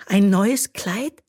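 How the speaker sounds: hope for a new dress; hopeful. A narrator's reading. Bö asking her mother, hopeful. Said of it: "fragte Bö hoffnungsvoll".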